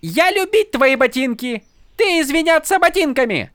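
high, bossy broken speech; mock Horneater accent. A narrator's reading. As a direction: demand payment grandly. Fake Horneater demands boots.

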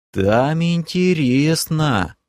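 very slow, drawling elf; drawn out. Acted. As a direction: stretch it out dreamily. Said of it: "протянул отмерший на секунду лысый эльф".

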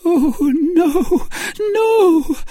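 very high, loud panicked scream; Milady retreating to the wall in terror; terrified cry. A narrator's reading. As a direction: recoil screaming from the sight. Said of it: "she suddenly cried".

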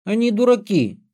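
высокий голосок обиженной девочки; буркни по-детски обиженно, но тоненько; насупленная малышка. A narrator's reading. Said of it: "насупилась маленькая дроу". (обиженным детским голоском)

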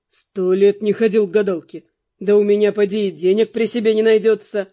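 a bored prisoner wryly refusing; prisoner's voice. A narrator's reading. Prisoner at the window answering the fortune-teller, low and wry.

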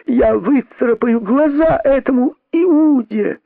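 high slow vengeful shriek; furious widow. A narrator's reading. Widow threatening the traitor, hysterical. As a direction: threaten him in hysterical rage. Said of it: "истошный крик вдовы".

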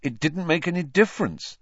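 put-on high, bitter boy's protest. A narrator's reading. Pupil rejecting his headmaster's praise, bitterly. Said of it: "said Harry bitterly".

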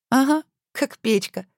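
mocking echo, high and slow; ироничный смешок. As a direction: tease him, echo his words slowly. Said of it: "Александра хихикнула". (насмешливо, протяжно)

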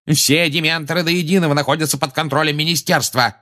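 high, loud, indignant official; angry official booming a denial; thundering outburst. A narrator's reading. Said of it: "прогремел Фадж".

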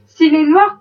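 ordinary delivery

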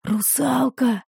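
slow, high, amazed «Русалка!»; awed echo. Acted. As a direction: repeat it, stunned by wonder. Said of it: "эхом повторили все".

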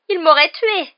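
high, loud panicked cry; desperate wail. A narrator's reading. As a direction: wail it to save yourself. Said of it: "gémit Petit Gros". (cri plaintif affolé)

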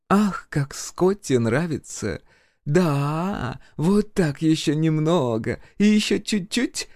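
put-on high, slow, drawn-out baby talk; cooing to a pet. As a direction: coo while scratching the dog's chest. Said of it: "приговаривая". (сюсюканье с собакой, высоко и протяжно)

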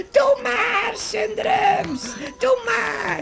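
egging on. Acted. Girl cheers on the Sundrums from the window. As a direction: cheer them on with glee.

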